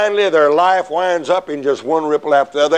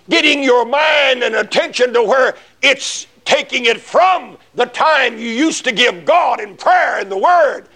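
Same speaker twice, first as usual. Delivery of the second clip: preacher pressing the point hard, voice raised; fervent preaching; 10 semitones higher than usual. Acted. Preacher warning the congregation, raised fervent preaching.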